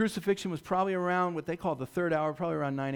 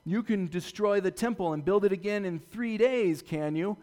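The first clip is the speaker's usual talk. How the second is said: mocking; 5 semitones higher, 5 dB louder than usual. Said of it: "they would say things like this".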